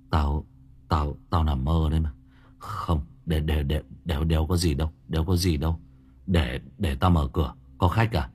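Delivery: mumble, still shaken from the dream; low stunned mumbling; dazed. Acted. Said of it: "Thằng Cường choáng váng".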